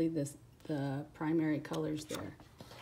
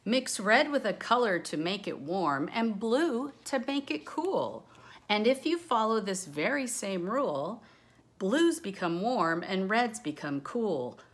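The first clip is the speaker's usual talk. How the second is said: louder, lilting read-aloud of rhyming verse; reading rhyme aloud; 4 semitones higher, 6 dB louder than usual. Acted. Teacher reading a rhyming color book to the class, sing-song. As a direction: bounce through the rhyme like a storybook.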